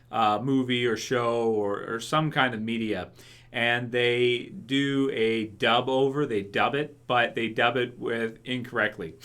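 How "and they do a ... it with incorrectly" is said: usual speaking voice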